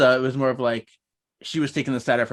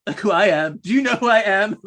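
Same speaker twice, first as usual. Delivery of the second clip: louder, rising outrage; indignant diva; 6 semitones higher, 6 dB louder than usual. Retold or imitated. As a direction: do you know who I am diva. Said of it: "She's like".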